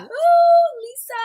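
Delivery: high delighted ooh; thrilled high-pitched ooh; excited squeal. Real, not acted. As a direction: squeal with joy at seeing her.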